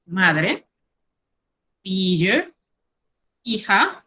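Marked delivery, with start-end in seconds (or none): none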